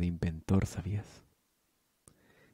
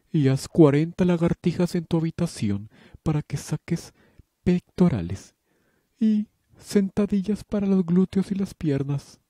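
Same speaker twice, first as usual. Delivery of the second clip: high and loud, barking like a gym coach; mock drill-sergeant orders; 10 semitones higher, 7 dB louder than usual. Headmistress yelling exercise orders at Candy, loud and high. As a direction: yell the workout at her like a drill instructor.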